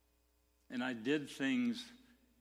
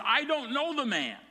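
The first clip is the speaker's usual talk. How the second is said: high, louder sworn denial; emphatic denial; 12 semitones higher, 8 dB louder than usual. Retold or imitated. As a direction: swear it off with force. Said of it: "this time with an oath".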